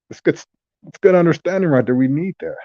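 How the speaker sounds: high fast excited praise. Real, not acted. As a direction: sell it with a burst of enthusiasm. Enthusiastic endorsement.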